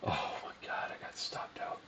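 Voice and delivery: real whispered dismay at being stopped out. Real, not acted. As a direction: whisper it, crushed. Stopped out of a trade.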